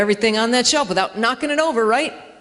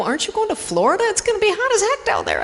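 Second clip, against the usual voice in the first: higher, quicker incredulous friend; 9 semitones higher, faster than usual. Retold or imitated. Friend driving to the airport, incredulous and high. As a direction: play the friend, baffled and rising. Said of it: "And they go".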